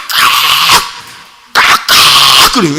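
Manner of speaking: harsh drawn-out 깍 squawks; imitating a chicken's screech; mock rooster squawk. Shown as a demonstration. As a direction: squawk like a frantic hen. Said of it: "어떤 소리야".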